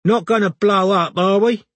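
high, loud anxious question; nervous stoner panic; scared. A narrator's reading. Neighbour scared of gas.

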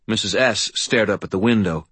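usual speaking voice

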